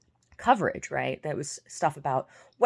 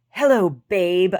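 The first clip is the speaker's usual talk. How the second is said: slow, much louder, drawn-out come-on; mock sleazy greeting; 4 semitones higher, 10 dB louder, slower than usual. Mocking the fake Bogut's pickup line, slow and drawn out. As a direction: ham up the creep's pickup line. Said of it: "he was like".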